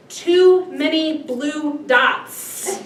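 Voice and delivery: very slow, loud, word by word; imitated kid's answer. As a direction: space out each word, imitating a matter-of-fact kid. Acts out kid's literal answer.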